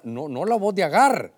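ordinary talk, nothing marked

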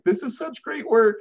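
high-pitched imitation of tearful gushing; squeaky-high mock weepy praise; mock bawling. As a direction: imitate an overcome new employee, voice way up. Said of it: "she's just bawling".

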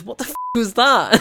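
high, loud burst of disbelief; shocked outburst. Retold or imitated. Pilot reacting to jet's weird maneuver, imitated shock. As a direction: blurt it out, imitating total astonishment.

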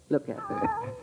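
high, soft coo to the cat; cooing at kitten. Acted. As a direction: coax the kitten gently.

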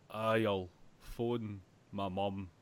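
slow, dim Scottish lad; a lightly voiced slow Scottish guy; Scottish accent. Podcast friend imitating dim Scottish gangster, slow Scottish accent. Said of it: "the one random Scottish guy".

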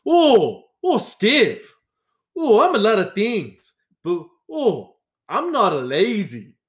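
put-on high, indignant Brazilian-accented protest; angry Brazilian accent. Brazilian coworker denying he is lazy, indignant accented protest. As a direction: imitate an offended Brazilian coworker, voice swooping way up. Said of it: "he was angry".

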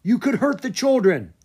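loud, high ringing order; mother ordering villagers to drop weapons; commanding shout. Acted. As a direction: stop the crowd with authority. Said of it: "Kalama's voice rang out".

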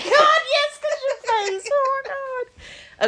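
high squealing laugh through the words. Real, not acted. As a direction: squeal with laughter at her face. Friend reacts to story setup.

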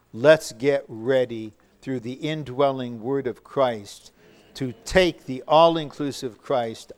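normal talking voice

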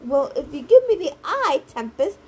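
loud complaint, leaping high on the key words; complaining. A narrator's reading. Cab driver snapping back at Tempest, complaining. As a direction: complain back at a nagging passenger. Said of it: "the driver complained".

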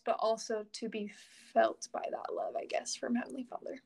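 choked-up near whisper, close to tears; holding back tears as the voice drops away; teary. Real, not acted. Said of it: "Sorry, I'm getting teary".

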